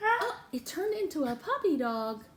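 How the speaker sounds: ordinary talk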